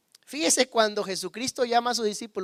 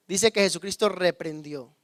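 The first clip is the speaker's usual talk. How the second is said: normal talking voice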